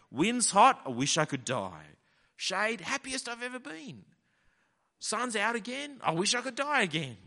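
high, loud petulant complaining; whiny Jonah. Retold or imitated. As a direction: whine it like a sulky child in the sun. Preacher voices sulking Jonah.

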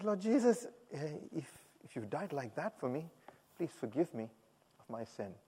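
very soft, halting prayer; hushed plea for forgiveness; humble prayer. Retold or imitated. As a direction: pray quietly, pausing between phrases. Retold first prayer for forgiveness.

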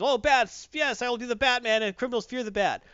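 high, loud hammed-up delight; mock excited realization. Acting out Batman's eager realization.

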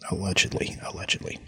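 very soft aside, almost under the breath; tiny-voiced legal disclaimer tucked in; hushed disclaimer. Real, not acted.

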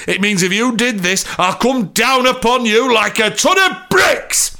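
building to a high shout on "bricks"; theatrical threat, slowing and rising; threatening inspector. A narrator's reading. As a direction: build up and hit "bricks" hard. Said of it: "For effect on the word bricks".

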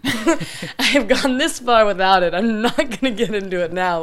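loud, laugh-tinged retort; amused, exasperated outburst; emphatic refusal. Retold or imitated. Acted retort to peer pressure, laughing.

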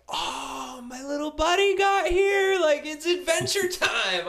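high, loud, thrilled shout; excited. Retold or imitated. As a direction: burst out with joy at the baby. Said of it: "I was like".

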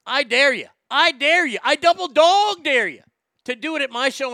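warning hecklers with a roaring taunt; furious dare. Real, not acted. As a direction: scream the dare right in their faces. Threatening hecklers at his show.

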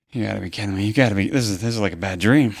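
soft, deflated disbelief; muttered despair to himself; stunned and quiet. Real, not acted. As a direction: say it under your breath, beaten. Alone after losing Kate.

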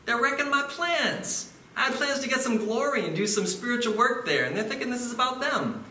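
God's voice. Acted. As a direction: sound like God fed up with proud people. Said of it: "God to be like".

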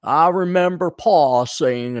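slow Texas drawl; hammed-up, drawn-out Texan reminiscing; Texas dope. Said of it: "the good old American Texas dope".

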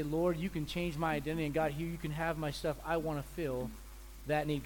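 soft humble prayer; quiet surrendering prayer. Retold or imitated. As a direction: give it all up to God, softly. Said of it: "they say".

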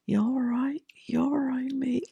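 high, whispered check-in; imitating a faint, faraway voice. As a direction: whisper it like a faint call from the back. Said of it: "I heard this faint".

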